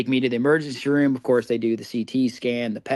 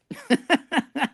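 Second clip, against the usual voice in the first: laughing; 12 semitones higher than usual. Real, not acted. Laughs about the rush to Nashville.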